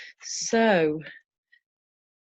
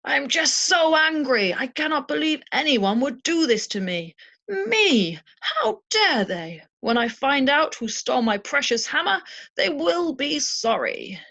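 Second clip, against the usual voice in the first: a self-righteous god fuming over his stolen hammer; indignant angry Thor; 6 semitones higher, 5 dB louder than usual. Acted. Teacher voicing Thor raging about the stolen hammer, loud indignant outrage. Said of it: "that was Thor speaking".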